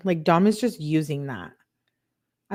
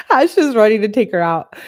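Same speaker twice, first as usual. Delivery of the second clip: loud, higher, laughing delivery; amused outburst; 4 semitones higher, 9 dB louder than usual. Real, not acted. Host laughing at Ash's comment, amused outburst. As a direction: burst out laughing as you say it.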